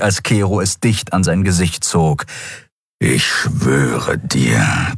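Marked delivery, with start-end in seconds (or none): 3.0-4.9 much lower, very slow beastly growl. Acted. Keru threatens the junkie, low and slow growl. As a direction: let it rumble low like a big predator. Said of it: "knurrte der Skriar".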